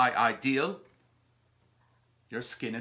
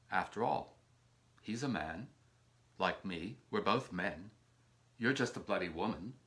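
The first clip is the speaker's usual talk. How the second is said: low contempt; 7 semitones lower, 8 dB quieter than usual. Acted.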